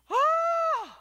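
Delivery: very high, loud held "oh"; hammed-up squeal of elation; overjoyed cry. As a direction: let out a long soaring whoop. Son's joy at getting car.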